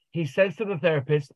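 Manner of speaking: usual speaking voice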